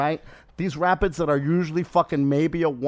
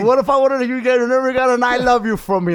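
mock worried rant; 7 semitones higher, 6 dB louder than usual. Acting out his worried rant.

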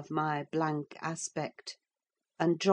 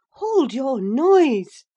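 loud, slow, raised-pitch rebuke; enraged scolding; 8 semitones higher, 9 dB louder, much slower than usual. A narrator's reading. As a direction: silence a clamorous girl, loud and drawn out. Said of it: "enraged at her clamorous manner".

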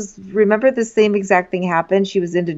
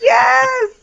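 excited; 3 dB louder than usual. Real, not acted. Sudden excited recognition.

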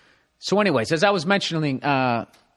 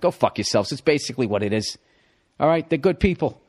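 ordinary delivery

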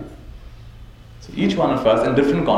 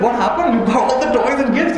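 high, baffled question; father can't understand the upset; mock bewildered father; puzzled father; 10 semitones higher than usual. Father questions complaining twin.

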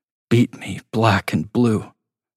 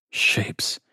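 whisper; 5 dB quieter than usual. A narrator's reading. Staring at ceiling patterns.

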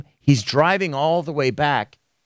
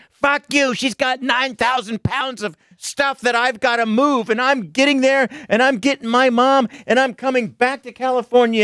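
much higher, defensive snapping voice; mock angry outburst; 11 semitones higher than usual. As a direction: play him snapping back at the fans, strained and high. [name] snapping back at fans.

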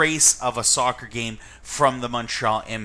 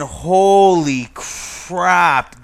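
exasperated exclamation; 5 semitones higher, 8 dB louder, much slower than usual. Real, not acted. Exclaiming at bad match.

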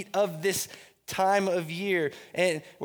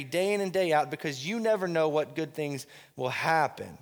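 slow, deliberate, each word weighed; careful unhurried voice. Shown as a demonstration. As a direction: say it slowly so it sinks in. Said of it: "let me say this slowly".

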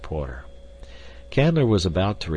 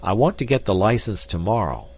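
forceful, louder blunt demand; 6 semitones higher, 5 dB louder than usual. A narrator's reading. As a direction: press for an answer without softening. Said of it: "he said bluntly".

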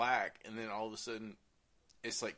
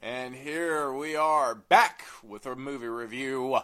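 announcer; 3 semitones higher, 12 dB louder than usual. Acted. Show intro announcement.

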